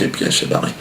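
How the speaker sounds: normal talking voice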